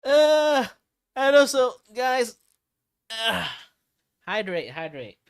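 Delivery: bright high streamer voice reminding chat; high-pitched voice. Real, not acted. Streamer telling chat to hydrate, in a high, loud voice. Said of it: "because of the high-pitched voice".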